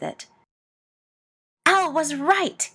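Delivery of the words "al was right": slow, higher, louder furious line. A narrator's reading. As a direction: bite it out slowly in fury.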